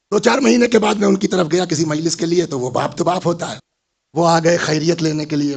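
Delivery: usual speaking voice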